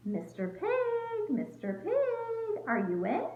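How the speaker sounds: high, slow drawn-out call; singsong call. Acted. Wolf calling at the stick house, slow high singsong. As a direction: stretch out each Mister Pig. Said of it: "so I called".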